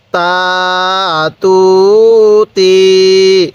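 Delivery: very slow, each vowel stretched long; drawn-out syllables. Shown as a demonstration. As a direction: stretch সা সু সি for a learner. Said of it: "পড়ুন।". (টেনে টেনে ধীরে উচ্চারণ)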